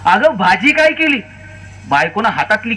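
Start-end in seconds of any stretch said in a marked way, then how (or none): 0.0-1.2 higher-pitched mock yell, "काय" hit hard; retold shout, pitched up; shouted into her ear. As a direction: yell "अगं, भाजी काय केली?" right into a deaf wife's ear. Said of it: "जोरात कानात ओरडला".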